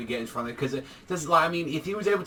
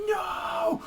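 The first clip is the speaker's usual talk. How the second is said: very high shouted no; scream; 3 dB quieter than usual. Retold or imitated. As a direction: shriek it out in despair. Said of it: "He just screams".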